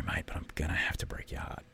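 breath-only hush, letting someone down gently; soft whisper to a hopeful contestant before sending them home; gentle whisper. Shown as a demonstration. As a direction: whisper it softly, like you're delivering bad news kindly. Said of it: "And the whispering's not a put on".

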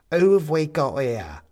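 a grinning little skeleton, broad Cockney. A narrator's reading. Tiny skeleton calling out to Benedict, loud Cockney. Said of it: "an incongruously Cockney accent".

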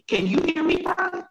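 choppy connection, words cutting out; radio breaking up. Real, not acted. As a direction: voice dropping out on a bad line. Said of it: "You're cut".

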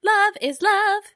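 high, loud, slow cheer; cheerleader. Pretend. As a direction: cheer it like a pep rally. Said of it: "my B D S M cheerleader voice".

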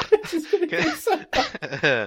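high, slow, giggling through words; words squeezed out between laughs; laughing while talking. Real, not acted. Laughing at own wrong answer.

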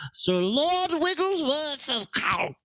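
high, grandly drawn-out aristocratic title; pompous lord. Pretend. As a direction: announce an absurdly grand nobleman. Inventing a grand British name.

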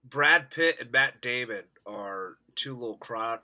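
ordinary talk, nothing marked

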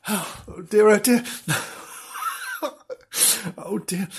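usual speaking voice

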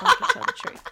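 high, loud, breathy laughing tease. Real, not acted. Teasing her co-host about lying. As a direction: giggle through the tease.

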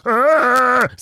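a sudden high yelled "Argh!", hammed up; mock demon-sucking scream. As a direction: let out a big movie-style strain. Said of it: "he was like".

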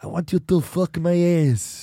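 put-on deadpan request in a thick Austrian accent; Arnold Schwarzenegger. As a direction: ask earnestly as the action star. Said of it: "Arnold, I can't".

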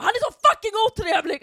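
complaining to the guard at full volume; retold yell. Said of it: "gick jag till vakten och jag bara".

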